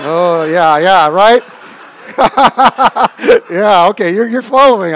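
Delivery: loud laughing yeah, okay; laughing loudly. Real, not acted. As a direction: laugh along with the crowd. Laughing at the audience catching on.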